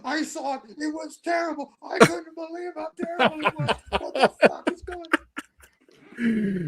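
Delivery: disgusted rant. Retold or imitated. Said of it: "you're sort of going".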